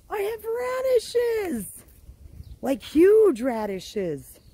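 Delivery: high, slow, giddy announcement; giddy delight. Real, not acted. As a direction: squeal it out with delight. Thrilled about her harvest.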